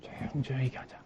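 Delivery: very soft, breathy hush showing the volume; hushed demo. Shown as a demonstration. 떠드는 아이에게 조용히 말하는 본을 보임. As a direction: say 조용조용히 얘기하자 as softly as you want them to speak. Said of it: "이 말도 실제 표현해 주는 거예요".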